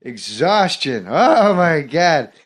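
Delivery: uncomfortable, dragged-out stand-up impression; Norm Macdonald impression. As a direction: ramble and circle back, stretching key words. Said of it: "I was doing Norm Macdonald".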